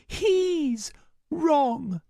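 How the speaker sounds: high, firm refusal; high girl voice. Acted. Starbreeze insists.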